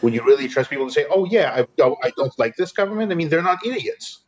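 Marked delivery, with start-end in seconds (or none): none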